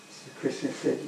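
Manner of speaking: ordinary delivery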